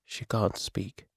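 soft, slow and weak; quiet, drained delivery. A narrator's reading. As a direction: let it out feebly, barely any volume. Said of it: "His voice was weak".

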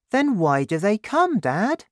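put-on eager boy asking his dad; young Danny. Danny asks, voiced high.

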